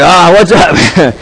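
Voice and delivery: own reaction. Retold or imitated. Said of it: "And I'm like".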